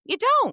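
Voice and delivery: DON'T punched high and loud; insist, with a big high, loud hit on DON'T; emphatic insistence. Real, not acted. Co-host backing Angie on pricing, emphatic insistence on DON'T.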